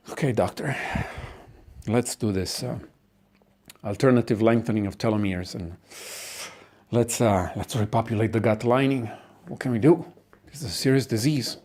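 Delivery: soft, halting agreement; resigned consent. Acted. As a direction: give in quietly to the doctor. Family gives in after the fight.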